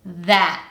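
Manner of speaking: THAT ending on a throat catch; glottal stop t. Shown as a demonstration. Teacher asks learners to listen again, glottal stop. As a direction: cut THAT off with no released t. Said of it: "I'm using a glottal stop at the end".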